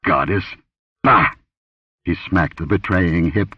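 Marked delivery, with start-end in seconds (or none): 0.1-1.3 scornful scoff. A narrator's reading. Captor scoffs at the exposed impostor.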